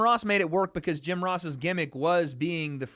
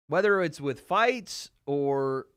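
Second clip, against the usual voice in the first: normal talking voice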